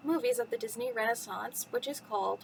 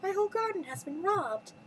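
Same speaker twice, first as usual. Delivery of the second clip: high storybook lament; lightly acted complaint; Belle sighing; 7 semitones higher than usual. A narrator's reading. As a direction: lament it in a raised voice. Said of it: "Belle sighed".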